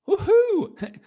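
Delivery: big high joyful whoop; excited cheer. Real, not acted. Teacher cheering about being robots.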